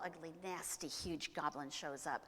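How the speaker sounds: ordinary delivery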